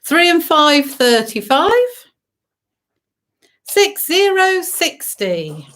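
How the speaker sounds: sing-song bingo number calling; bingo caller. Acted. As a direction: call each number out to the hall.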